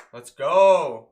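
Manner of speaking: loud, drawn-out cheer; excited cheer. Retold or imitated. Jays fan cheering a single, excited. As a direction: cheer it loudly, stretching the last word.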